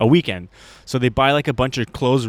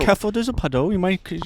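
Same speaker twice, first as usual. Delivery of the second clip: high Queen's-English warning; mock-posh British, pitched up; posh British accent; 7 semitones higher, faster than usual. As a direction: imitate a well-spoken Londoner. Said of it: "the same accent as the Queen".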